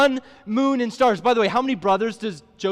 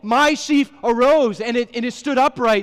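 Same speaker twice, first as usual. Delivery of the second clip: slow, slightly raised reading of the text; scripture reading; 3 semitones higher, 4 dB louder than usual. Acted. Pastor reading Joseph's dream to the congregation, slow and declaiming. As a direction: read the dream aloud with weight.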